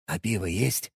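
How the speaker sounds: very soft whispered aside. Acted. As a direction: whisper it so he can't hear. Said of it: "прошептал Эбби".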